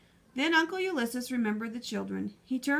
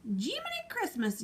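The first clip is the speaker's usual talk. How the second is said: mild exclamation; 6 semitones higher, 3 dB quieter than usual. Acted. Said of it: "yelled the sheriff".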